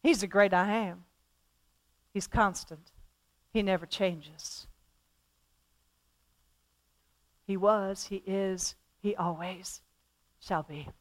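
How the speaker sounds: soft, low and reverent; hushed reverence. Real, not acted. Preacher declaring God's constancy in a low hush. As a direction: let it fall to a hush.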